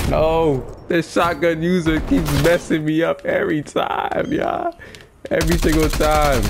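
high-pitched frustrated griping; exasperated complaint. Real, not acted. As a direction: gripe high, fed up with being killed. Complaining about repeated shotgun deaths.

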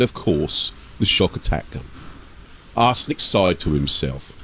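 steady storyteller read of an ork tale; story narration. A narrator's reading. Narrator telling an ork story to listeners, storytelling voice. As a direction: carry the listener through the scene.

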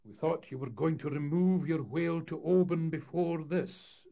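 a hint of a weary deputation pleading; mild sad complaint. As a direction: townsfolk complaining to the crew. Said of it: "said the deputation sadly".

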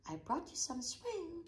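high, proud announcement; announcing. A narrator's reading. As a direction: present it like a gift. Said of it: "Morris announced".

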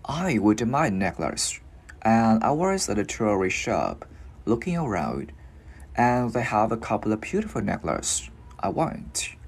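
slow, careful English read-aloud for learners; unhurried teacher's demonstration; slow model reading. Shown as a demonstration. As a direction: take it gently, word by word. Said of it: "mình cứ đi từ từ vào thôi".

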